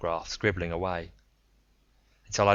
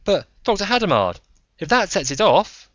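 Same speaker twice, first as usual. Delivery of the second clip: higher, louder voice through a tinny speaker; worried over speaker; 12 semitones higher, 9 dB louder than usual. Acted. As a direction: fret through the radio.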